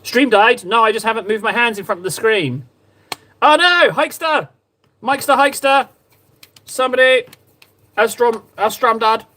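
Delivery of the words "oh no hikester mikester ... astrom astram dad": calling out. Real, not acted. Stream died, calling out to chat.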